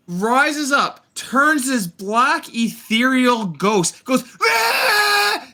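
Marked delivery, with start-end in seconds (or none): 4.4-5.5 high, sustained imitated screech; mock monster screech. Reviewer imitates Witch Mother turning into a ghost, mock screech. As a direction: screech like a transformed witch, held long.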